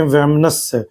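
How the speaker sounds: वैमनस्य drawn out very slowly, twice; teacher dictates the right spelling; very slow repetition. Shown as a demonstration. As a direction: stretch वैमनस्य out syllable by syllable. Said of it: "इसको ऐसे लिखते हैं".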